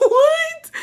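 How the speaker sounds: very high, stunned squeak; squeaky shocked what. Real, not acted. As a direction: gasp out the word in disbelief. Shocked reaction to something.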